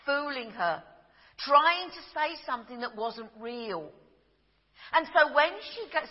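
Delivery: ordinary talk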